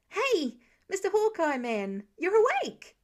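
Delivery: high, loud and delighted; thrilled teenager greeting a patient; excited greeting. Acted. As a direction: burst out with glee. Kid greets waking teammate.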